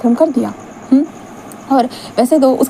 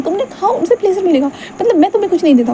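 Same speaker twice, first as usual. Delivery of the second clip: higher, upset and accusing; hurt boyfriend, higher and upset; hurt outburst; 8 semitones higher than usual. Acted. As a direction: spit it out like a jealous lover. Wing upset at boyfriend's remark.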